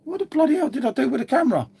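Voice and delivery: high, fast, bewildered self-talk; retold baffled panic. Streamer retelling his morning to viewers, high and bewildered. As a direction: replay the moment you realise the camera's gone. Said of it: "I'm just looking around thinking".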